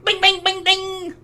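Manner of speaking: very high pinging bings; ping like a bullet bouncing; ricochet bings. Pretend. Imitating a ricocheting bullet, very high bings.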